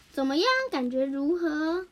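ordinary delivery

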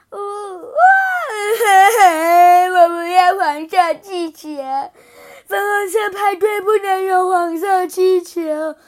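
loud sobbing tantrum, words bawled out; wailing cry. Acted. Princess wailing to everyone at her party, loud sobbing. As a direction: bawl it like a toddler in a meltdown. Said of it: "爱哭公主大哭起来".

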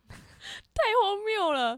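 drawn-out, high, incredulous; exaggerated disbelief. Real, not acted. Friend reacting to a story about crying on a Ferris wheel, slow mock disbelief.

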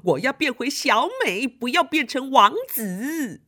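put-on high, whiny complaint as the prince-girl; whiny little girl. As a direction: wail about the beard and crown. Xiaomei upset, turned into prince.

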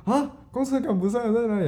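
high, concerned auntie voice; kind auntie. Retold or imitated. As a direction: ask the boy with eager worry. Auntie asks where the bus is. (阿姨的高声关心)

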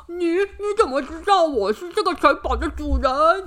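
startled monster stammer. Acted. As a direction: caught off guard, stuttering. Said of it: "怪兽吓了一大跳".

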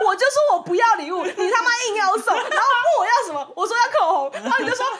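high, loud rant between laughs; loud laughing rant. Real, not acted. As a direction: vent it all out, laughing. 激动地数落送礼的人. (边笑边大声抱怨)

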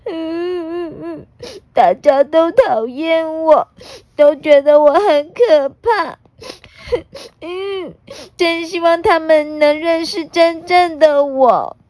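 high, weepy sobbing voice; crying. Acted. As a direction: cry out the words like a sad child. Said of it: "忍不住流下眼泪".

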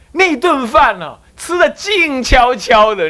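slow, high and drawn out; slow drawn-out telling of the hushed meal; slow stretched words. Real, not acted. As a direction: stretch it to show the silence. Describing silent meal.

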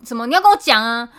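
loud, high mock yell at the customer; hammed-up furious shopkeeper; imitating an angry shopkeeper. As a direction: bark it like a grumpy old shop owner. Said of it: "他就说".

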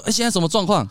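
high, loud what-is-going-on; sudden confusion at the fight; bewildered. Retold or imitated. Watching the women suddenly quarrel, high bewildered. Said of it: "我心里想说".

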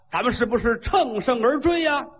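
plain reading voice

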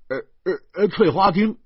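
high, loud stammering announcement; flustered host. A narrator's reading. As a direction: blurt the room name, then hurriedly correct it. Flustered host calls out room name.

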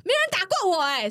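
high, aggrieved mock protest; lightly voiced whiny outraged coworker; imitating angry coworker. Beaten coworker protests being hit, mock angry and high. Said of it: "然后他就很生气，他说".

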